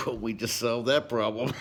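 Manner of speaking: called out loud and pleased; cheerful shout. Retold or imitated. To the lonely neighbor, a cheerful shout. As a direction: announce the fix with a happy shout. Offering neighbor a fishing buddy.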